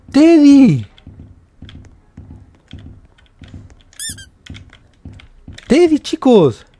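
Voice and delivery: high, loud shriek of alarm; startled scream. Real, not acted. Gamer shrieking to viewers as Teddy appears, startled scream. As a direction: scream as if jump-scared.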